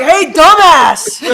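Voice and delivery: loud, drawn-out heckle; mock insulting shout. Host imagining guest correcting her rudely, hammed-up shout.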